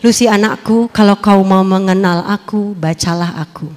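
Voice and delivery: God's voice, lightly voiced; a hint of God's voice. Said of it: "Suara itu bilang apa?".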